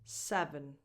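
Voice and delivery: Seven, second syllable reduced to a schwa. Shown as a demonstration. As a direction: model Seven slowly for learners. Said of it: "It's just a schwa".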